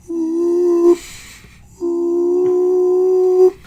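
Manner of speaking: high, loud two-tone whoop; weird whoop noise. Shown as a demonstration. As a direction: make a strange doubled-voice whoop. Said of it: "I'll try and do it".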